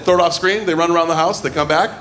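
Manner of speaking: normal talking voice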